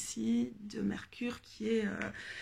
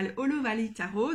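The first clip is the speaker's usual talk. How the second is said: ordinary delivery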